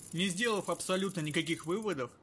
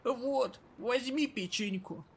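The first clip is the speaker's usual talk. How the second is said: put-on raspy old woman; 7 semitones higher, slower than usual. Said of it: "раскрыла старушка пакет".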